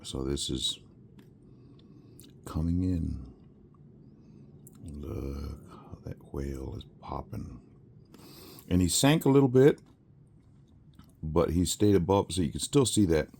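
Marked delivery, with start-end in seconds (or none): 5.0-7.6 whisper. Real, not acted. Quiet marvel at the whale.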